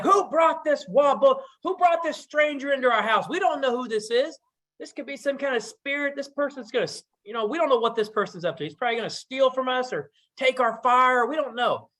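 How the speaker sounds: high, angry tirade; father scolding daughters, high and angry; furious father. Acted. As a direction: lay into the daughters, raising the pitch.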